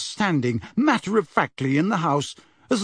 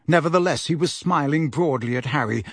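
usual speaking voice

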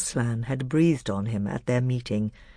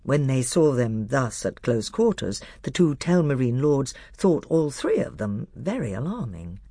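plain reading voice

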